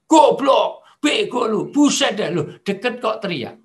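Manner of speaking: loud, high furious insults; angry yelling. Retold or imitated. Speaker imitates an angry person yelling up close, furious shout. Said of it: "Itulah orang marah".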